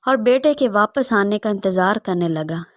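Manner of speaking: ordinary delivery, nothing marked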